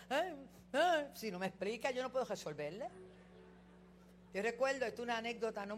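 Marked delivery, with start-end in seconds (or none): 0.1-1.1 loud, high imitated sobbing "eh, eh"; mock child whimper. Mother imitates her crying son, loud high mock whimper. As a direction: play a crying child who can't explain. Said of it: "un hijo mío viene a llorar".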